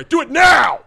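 high, loud mock order; shouted command. Host ordering a player to run a route, mock shout. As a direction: yell it like an urgent demand.

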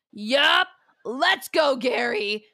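loud, high rallying shout; punchy acted battle cry; fired-up fighter. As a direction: call out ready for a fight. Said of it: "Shelby goes".

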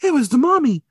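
high, put-on cartoon Velma. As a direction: squeak it out as a certain sleuth. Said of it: "'cause Velma was like".

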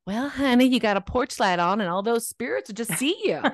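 light Southern grandma voice, knowing and amused; Southern grandma accent. Retold or imitated. Grandma explaining spirits to a child, light Southern accent. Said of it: "my grandma's like".

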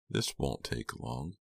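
slow, soft cold threat; calm menace, draw it out; menacing villain voice. A narrator's reading. Farberson voiced, slow and quiet.

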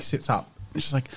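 ordinary talk

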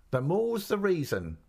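high, slow, insistent retort; emphatic. A narrator's reading. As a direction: come back firmly, drawing it out. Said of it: "she was so emphatic with it".

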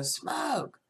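alarmed cry. Retold or imitated. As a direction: sound the alarm to the crowd. Crowd panic builds.

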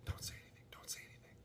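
urgent whisper; scared whisper in the middle of a meeting. Retold or imitated. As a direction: hiss it under your breath so nobody hears. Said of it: "poor guy's like".

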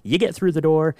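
normal talking voice

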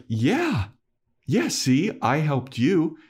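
high-pitched hopeful retold reply; mock eagerness. Spike claims credit to Giles, mock eagerness. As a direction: grab the opening, play-acting eagerness.

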